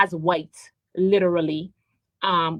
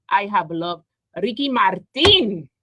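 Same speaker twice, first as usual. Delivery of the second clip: usual speaking voice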